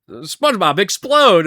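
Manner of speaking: high, loud cartoon outburst; mock cartoon yell. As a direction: shout it as a cartoon imitation. Quoting cartoon line.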